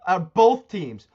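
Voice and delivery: loud, punchy wishes of defeat; loud sports-hater tirade; heated rant. Real, not acted. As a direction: raise pitch and volume, hammering each outcome. Ranting against Boston fans.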